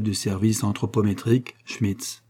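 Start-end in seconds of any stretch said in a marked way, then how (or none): none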